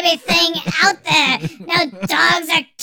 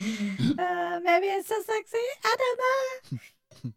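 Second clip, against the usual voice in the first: woman voice filter; 5 semitones higher, 8 dB quieter than usual. Real, not acted. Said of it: "This voice thing is gonna be fantastic".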